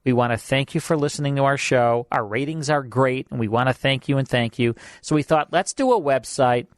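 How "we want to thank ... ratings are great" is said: normal talking voice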